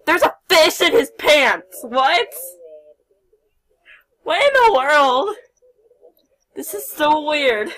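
loud disbelief at the weirdness; astonished outburst. Real, not acted. Fish found in suspect's pants.

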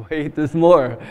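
loud hammed-up infomercial announcer; infomercial pitchman. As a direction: sell it like a late-night TV ad. Mocking infomercial pitch, louder.

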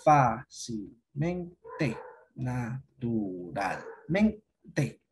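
very slow, "fácilmente" and "naturalmente" split into separate syllables; drawn-out teacher's syllable demo of "fácilmente, naturalmente". Shown as a demonstration. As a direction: sound out "fácilmente, naturalmente" one syllable at a time, pausing between. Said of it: "la que recibe la mayor fuerza de voz".

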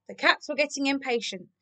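ordinary delivery, nothing marked

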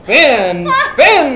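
rising, gleeful game call; freeze-dance caller, imitated. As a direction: mimic a party game leader egging kids on. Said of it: "then I played free, freeze dance".

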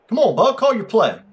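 high, loud goading call; impatient prodding. Real, not acted. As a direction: hurry a stalling opponent along. Impatient with a stalling opponent.

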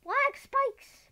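high, loud frustrated yell; sudden furious shriek; angry outburst. Real, not acted. Furious at lag.

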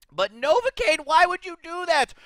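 high, pleading disbelief; exasperated plea. Real, not acted. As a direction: wail the question at the player. Pleading with the player who quit.

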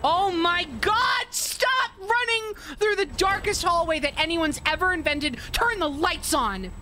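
high, frantic scolding shout; exasperated yelling. Real, not acted. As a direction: yell at the character in scared exasperation. Frightened, scolding the game character.

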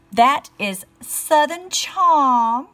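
slow, drawn-out Southern lilt; mock Southern belle, slow and loud; put-on Southern drawl. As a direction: stretch it out sweetly Southern. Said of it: "that's not even how we talk in the South".

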